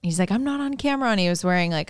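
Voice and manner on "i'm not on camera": higher-pitched retold excuse; mock protest. Biggio excusing his lack of suit, mock protest. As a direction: lightly imitate a defensive protest, pitched up.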